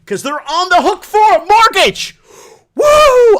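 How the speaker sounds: high, loud gleeful shout ending in a whoop; excited yell. Real, not acted. Mocking landlords with a loud high whoop. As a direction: yell it out like a crowing gotcha.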